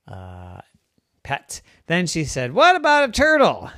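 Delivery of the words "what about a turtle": much higher, louder, quicker; high, loud burst of eagerness; eager idea. A narrator's reading. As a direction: pipe up with a sudden idea. Storyteller voices girl's bright idea.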